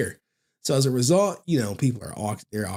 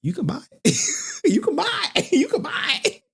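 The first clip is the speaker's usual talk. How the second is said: high voice cracking with laughter; laughing mock seller; 4 semitones higher than usual. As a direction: laugh through the sales pitch. Seller mocking crying fan.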